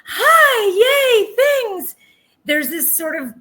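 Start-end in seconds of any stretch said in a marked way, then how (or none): 0.0-2.0 much higher and louder, gushing sing-song greeting. Retold or imitated. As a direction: put on fake bubbly happiness over the hurt. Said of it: "to me, this, like".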